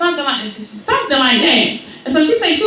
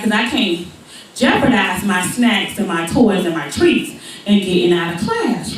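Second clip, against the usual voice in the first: ordinary talk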